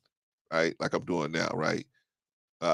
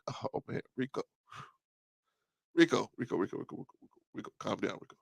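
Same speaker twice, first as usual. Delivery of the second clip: very soft, near-whispered calming; weary hushed pleading; 10 dB quieter, faster than usual. Acted. Host replies to his hollering friend on the phone, weary and hushed. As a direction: trail down under the caller's noise, pleading softly. Weary reply to yelling caller.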